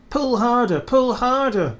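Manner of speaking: high, straining call; puffing little engine. Acted. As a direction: push and puff hard. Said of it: "puffed Thomas to Gordon".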